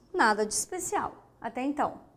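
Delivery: ordinary delivery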